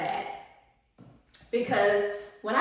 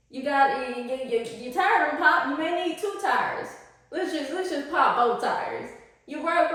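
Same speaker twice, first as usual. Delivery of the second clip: the universe, mocking; 5 semitones higher than usual. The universe taunting a broke person with new bills, gleefully. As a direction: play the universe gloating over your broke self. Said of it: "And the universe is like".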